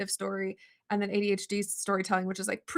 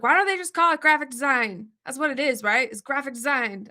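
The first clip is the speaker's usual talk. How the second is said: higher, louder exasperated complaint; mock mad rant; 4 semitones higher, 6 dB louder than usual. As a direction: vent about the silly major name, half-joking. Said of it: "I always was mad".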